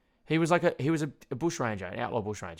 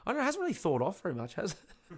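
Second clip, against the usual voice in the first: high-pitched, playing clueless; feigned innocence; 8 semitones higher than usual. Kid covering up to dad about thawing meat, feigned innocence. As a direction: pretend you know nothing, pitched up. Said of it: "pretend like".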